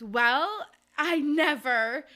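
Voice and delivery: slow, loud affronted gasp; a hint of a scandalised society lady; prim indignant matron. Missus Featherington scandalised by the scene. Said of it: "Missus Featherington goes".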